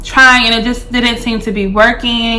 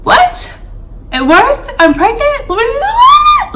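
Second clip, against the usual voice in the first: excited disbelief. Retold or imitated. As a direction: shriek with joy at the news. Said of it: "I was so excited".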